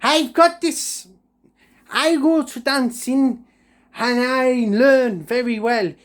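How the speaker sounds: high, slow showing-off voice; a high slow brag; cocky boast. Retold or imitated. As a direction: stretch it out high and smug. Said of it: "I went".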